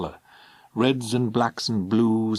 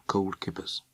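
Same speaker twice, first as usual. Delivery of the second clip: softly muttered to herself; quiet murmur; 2 semitones lower, 7 dB quieter than usual. Acted. Alison's private calming phrase.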